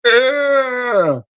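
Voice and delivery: very high, hammed-up rasping scream; mock corpse shriek. Dehydrated corpse cries out.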